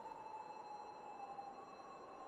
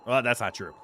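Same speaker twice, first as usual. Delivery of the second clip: normal talking voice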